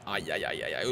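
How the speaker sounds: soft, low string of ouches; low dismayed muttering. Real, not acted. Gamer reacting to his side's poor defending, quietly dismayed.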